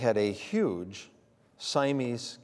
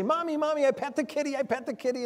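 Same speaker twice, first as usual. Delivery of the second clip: put-on much higher, thrilled child's voice; imitating a little daughter thrilled to have touched the cat; excited little girl; 13 semitones higher than usual. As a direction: imitate a proud toddler calling her mom. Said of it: "they would get all excited and say".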